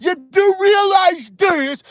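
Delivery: very high, drawn-out lecturing caricature; mock finger-wagging expert. Streamer mimicking experts wagging the finger, high mocking voice. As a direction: put on a shrieky, self-important know-it-all. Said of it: "wagging the fucking finger about how".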